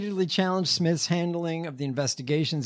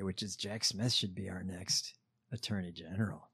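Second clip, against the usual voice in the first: whispering; 9 semitones lower, 12 dB quieter than usual. Real, not acted. Aside while reading a quote.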